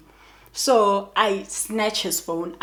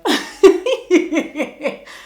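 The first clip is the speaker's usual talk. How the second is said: high embarrassed laugh; real giggle at yourself; 7 semitones higher, 6 dB louder than usual. Real, not acted. Laughing after admitting regret.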